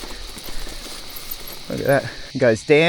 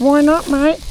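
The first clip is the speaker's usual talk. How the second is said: much higher put-on voice, jokey; mock other voice; 9 semitones higher than usual. Arguing with himself about the hill, a high put-on voice. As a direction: answer yourself in a pitched-up silly voice.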